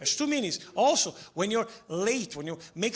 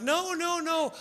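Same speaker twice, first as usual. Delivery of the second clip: mock woman's protest; 9 semitones higher, slower than usual. Woman's voice in retold scene.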